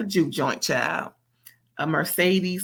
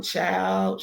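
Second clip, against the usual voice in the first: normal talking voice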